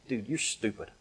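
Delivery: soft, dismissive aside; imitating a quiet, unimpressed listener; muted put-down. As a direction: voice a critic under your breath. Imagined listeners' verdict on the boaster.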